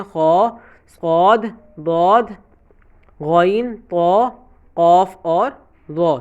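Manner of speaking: خا صاد ضاد غین طا قاف را each full and heavy, unhurried; heavy letters recited. Shown as a demonstration. Teacher recites heavy letters again so students memorize them. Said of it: "یاد رکھیے گا کہ موٹے کون سے ہوتے ہیں".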